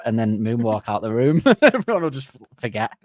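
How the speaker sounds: usual speaking voice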